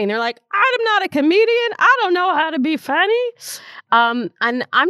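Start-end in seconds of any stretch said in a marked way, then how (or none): 0.5-3.3 very high-pitched, louder mock panic; exaggerated high voice imitating nervous objectors; anxious protest. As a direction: squeal it like someone panicking at the very idea. Voicing anxious people's protest.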